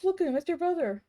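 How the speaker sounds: ordinary delivery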